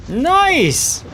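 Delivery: high, bright cheer; excited. Real, not acted. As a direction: burst out with delight. Cheers a friend's bourbon.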